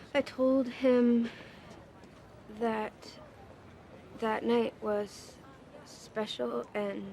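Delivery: pained, low-key admission; halting confession. Acted. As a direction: get it out a few words at a time, reluctantly. Daughter painfully confesses letter's contents.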